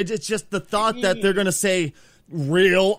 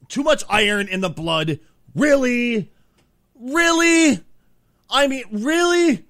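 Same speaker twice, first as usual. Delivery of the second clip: incredulous; 9 semitones higher, 5 dB louder, slower than usual. Acted. Mocking a movie line in disbelief.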